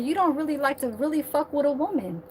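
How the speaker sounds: ordinary delivery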